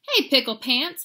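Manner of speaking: loud, high holler of Mama's. Acted. Mama hollering after her muddy kitty boy, loud. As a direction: call out across the yard. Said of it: "hollered Mama".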